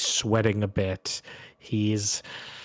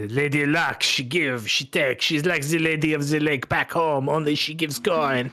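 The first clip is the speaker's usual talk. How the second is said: put-on playful French-accented gambler; Bretonnian French accent; 5 semitones higher than usual. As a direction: wax fond of luck in a French accent. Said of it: "I would expect as much with such an accent".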